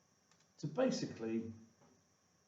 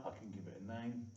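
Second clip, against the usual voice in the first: usual speaking voice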